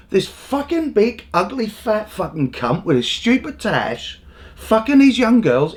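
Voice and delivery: ordinary delivery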